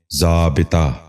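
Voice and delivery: ضابطہ with short "i" in the middle, "zaa-bi-taa"; correct pronunciation. Shown as a demonstration. Teacher giving viewers the model, correct pronunciation. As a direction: say ضابطہ as zaabitaa. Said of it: "غور سے سنیے".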